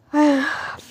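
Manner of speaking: acted long exhale to calm down; deep long sigh. Alisha collects herself after yelling, acted deep sigh. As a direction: breathe out deeply, letting anger go. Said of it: "एक गहरी लंबी सांस लेती है अलीशा".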